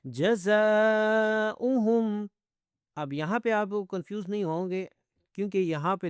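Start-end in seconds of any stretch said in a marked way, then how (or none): 0.0-2.3 جزاؤہم with a long held "zaa"; slow tajweed madd on جزاؤہم; elongated recitation. Shown as a demonstration. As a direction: chant جزاؤہم slowly, higher, stretching the alif. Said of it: "تھوڑا سا لمبا بھی کریں گے".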